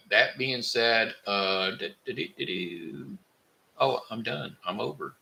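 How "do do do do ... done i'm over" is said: ordinary talk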